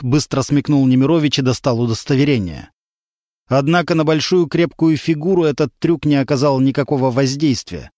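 ordinary delivery, nothing marked

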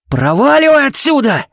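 high, full-throated yell; furious yell. A narrator's reading. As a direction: scream him out of here. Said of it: "проорал Кевин".

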